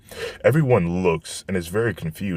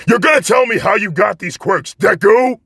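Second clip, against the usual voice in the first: screamed much higher and louder, demanding; furious shout; 14 semitones higher, 7 dB louder than usual. Acted. Bakugo storms at Izuku demanding his secret: raging shout. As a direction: bellow it right in his face. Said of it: "while yelling".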